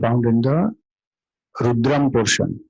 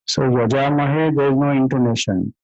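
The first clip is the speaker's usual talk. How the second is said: ordinary talk, nothing marked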